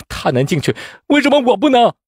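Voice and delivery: outraged protest jumping high and loud; indignant. A narrator's reading. Poor candidate protesting unequal treatment, indignant. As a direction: demand fairness, voice shooting up. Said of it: "周阳气愤道".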